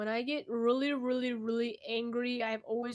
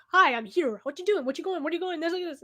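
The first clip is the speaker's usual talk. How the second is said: high, loud, rushed chatter of the voices; 6 semitones higher, 7 dB louder than usual. Retold or imitated. Voicing her hallucinated voices as they rush in, loud and high. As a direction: play the voices crowding in at once. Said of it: "they come in, like, bulk".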